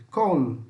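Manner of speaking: "Col" with the final L held and pulled; teacher stressing the L in "Col". Shown as a demonstration. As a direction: lean on the L in "Col". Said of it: "tenta dar uma puxada nele".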